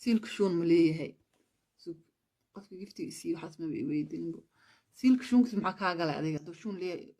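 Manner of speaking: normal talking voice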